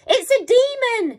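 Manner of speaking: very high, loud panicked scream; terrified yell. A narrator's reading. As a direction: shriek in terror. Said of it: "she yelled".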